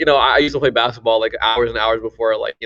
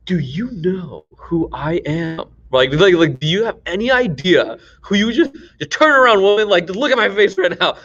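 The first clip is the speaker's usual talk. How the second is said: mock indignant self-importance; 4 semitones higher than usual. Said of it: "You're like".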